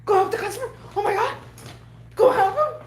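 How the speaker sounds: high, urgent, laughing orders; mock frantic boss. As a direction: push her toward the customer. Boss hurrying her to customers.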